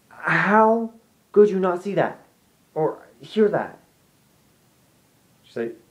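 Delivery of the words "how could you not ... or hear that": high, slow, disbelieving whine; incredulous. Retold or imitated. Retelling arguing with mom about the scream, high slow disbelief. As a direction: stretch it out in disbelief. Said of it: "I'm just like".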